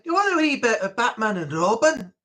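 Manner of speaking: lightly voiced Scottish speaker; a touch of Scots; mock Scottish offer; Scottish accent. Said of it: "someone would say".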